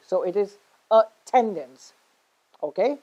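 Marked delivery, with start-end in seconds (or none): 0.9-1.9 attendance with TEN stressed, the A kept light; stress on second syllable. Shown as a demonstration. Teacher showing learners where attendance is stressed, stress on TEN. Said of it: "We stress on the second".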